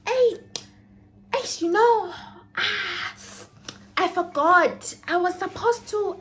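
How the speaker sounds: high, sing-song casual hinting; fake casual. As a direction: act breezy and innocent. Fake casual hint to mom.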